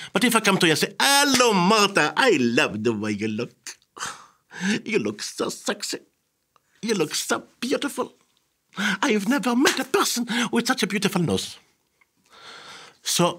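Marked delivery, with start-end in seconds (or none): 1.0-11.6 high, gushing greeting with breathy compliments; effusive flattery. Acted. Interviewer charming an interviewee before questions, gushing flattery. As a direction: swoon over them, mostly high and theatrical.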